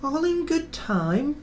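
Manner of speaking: high whiny carriage grumble; grumbling coaches. A narrator's reading. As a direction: drag it out like sulky carriages. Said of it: "the coaches grumbled".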